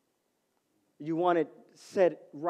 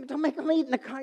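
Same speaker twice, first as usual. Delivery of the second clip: fussy instruction; 8 semitones higher, 5 dB quieter, faster than usual. Retold or imitated.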